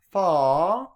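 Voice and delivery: "fa" loud with a round, open vowel; right fa. Shown as a demonstration. Teacher showing the correct round fa. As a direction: project "fa" fully round. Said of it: "not fa".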